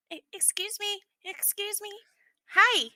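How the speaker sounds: put-on high, hesitant small child; timid little boy voice. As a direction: stammer it like a shy kid. Shy little boy character speaking.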